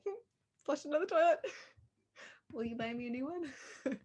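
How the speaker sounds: sheepishly confessing to the manager; sheepish confession. Retold or imitated. To her manager about the lost clicker, sheepish. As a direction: mumble it like a guilty employee asking a favor.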